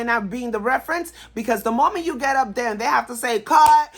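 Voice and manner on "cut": sharp, loud call of cut; director's call. Retold or imitated. Director calls cut on a bungled take, loud and sharp. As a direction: bark it like a director stopping the take.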